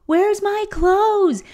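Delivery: put-on a kid eagerly asking for his outfit; eager little boy. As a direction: her son ready to dress, high and slow child voice. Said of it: "he goes".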